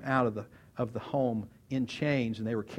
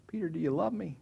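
mildly raised, unforced question; gentle question; 4 semitones higher than usual. Acted. Jesus looking across the fire at Peter, gentle. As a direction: ask it simply, without pushing. Jesus asks first time.

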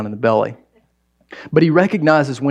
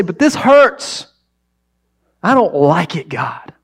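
acted protest to God; 4 semitones higher, slower than usual. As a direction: cry out in mock protest.

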